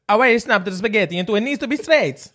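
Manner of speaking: over-the-top cartoon Italian complaint; Italian accent. Friend mocking an Italian chef about snapped spaghetti.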